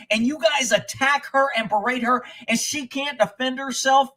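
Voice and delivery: retold angry tirade at a cowardly father; chewing him out. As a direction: tear into him, voice up high and loud. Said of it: "I fucking chewed his ass out".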